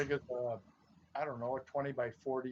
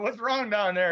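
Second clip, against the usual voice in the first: calling down; 7 semitones higher, 7 dB louder than usual. Retold or imitated. Friend calling down hill.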